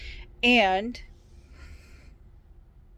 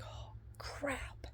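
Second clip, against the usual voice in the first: whispered dismay; 5 semitones higher, 15 dB quieter than usual. Retold or imitated. Realizing wardrobe malfunction.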